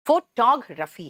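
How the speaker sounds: फोटोग्राफी slowly as fo-TOG-ruh-fee; one drawn-out word, fo-TOG-ruh-fee, for फोटोग्राफी; joined-up pronunciation. Shown as a demonstration. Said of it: "अलग अलग नहीं है".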